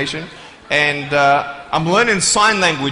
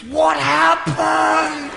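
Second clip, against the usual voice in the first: put-on little boy asking; 10 semitones higher, much slower than usual. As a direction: stretch it out like a curious shouting kid. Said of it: "and he goes".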